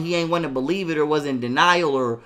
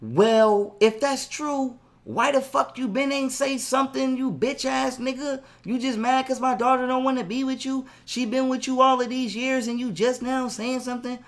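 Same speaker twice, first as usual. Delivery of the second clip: high, softer mock-mother voice; angry mother imitation; 5 semitones higher, 3 dB quieter than usual. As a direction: imitate her mother's high-pitched tirade. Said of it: "her mother was like".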